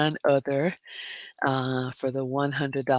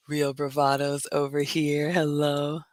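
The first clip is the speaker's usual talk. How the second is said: ordinary delivery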